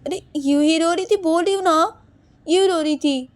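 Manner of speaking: loud, high insistence; exasperated denial. Acted. Snapped repeated denial. (चिढ़कर ऊँची, तेज़ आवाज़ में)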